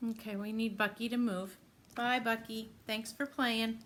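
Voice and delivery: usual speaking voice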